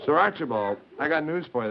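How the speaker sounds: ordinary talk, nothing marked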